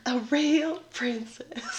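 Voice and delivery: whisper. Pretend. Repeats key phrase.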